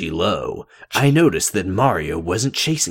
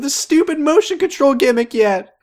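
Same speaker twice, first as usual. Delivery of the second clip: very high fast whining; whiny high voice; 5 dB louder, faster than usual. Pretend.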